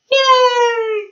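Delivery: high, drawn-out mock "yay"; mock cheer. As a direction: cheer sarcastically in a high, loud squeal. Mock cheer about ruining health.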